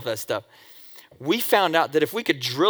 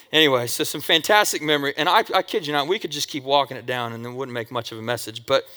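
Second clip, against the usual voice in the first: ordinary delivery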